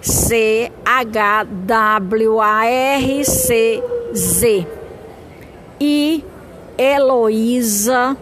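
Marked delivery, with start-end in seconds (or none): 0.0-4.7 letters spelled one by one, slowly; spelling it out. Shown as a demonstration. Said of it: "eu vou assoletrar o nome".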